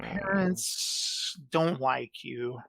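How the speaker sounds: put-on slow voice of an evil overlord; Palpatine. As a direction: draw it out slowly with dry, imperious disdain. Mocking impression of Palpatine snubbing Vader.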